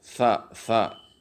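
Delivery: "Sa, sa", each with tongue tip against the teeth; two separate lisped "sa" syllables, th-like; letter pronunciation demo. Shown as a demonstration. Teacher drills the letter, "sa" with tongue at the teeth. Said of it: "ujung lidah dirapatkan dengan gigi".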